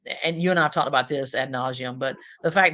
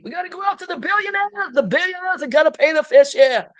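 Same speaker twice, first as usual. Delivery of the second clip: very high mock politician rant, building louder; Bernie Sanders; 13 semitones higher, 7 dB louder than usual. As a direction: imitate an old campaigner hollering, hammed up. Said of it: "when you hear him speak".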